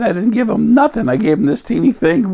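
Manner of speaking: high, loud protest of innocence; the driver insisting it wasn't him; defensive driver. Retold or imitated. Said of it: "And he said".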